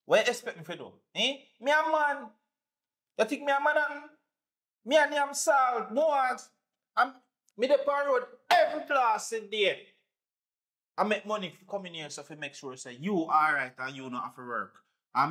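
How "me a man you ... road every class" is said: much higher, heated defense; husband insisting on his manhood; defiant protest. Acted. Husband shouting back at his wife, higher pitched.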